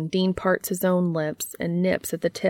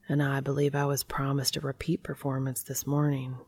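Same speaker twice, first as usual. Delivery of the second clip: soft, low-key murmur close to the ear; hot whisper; 3 semitones lower, 6 dB quieter than usual. A narrator's reading. Dean teasing his lover up close, hot whisper. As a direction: say it hushed and intimate, for one listener. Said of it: "Dean whispers hotly in his ear".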